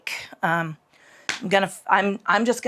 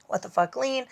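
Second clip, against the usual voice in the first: normal talking voice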